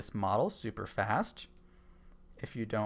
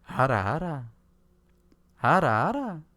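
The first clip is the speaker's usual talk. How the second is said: breathy teasing ara ara, rising; sultry ara ara; 4 semitones higher, 7 dB louder than usual. Pretend. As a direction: coo it out teasingly, a touch sultry. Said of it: "I'm gonna get kinda quiet".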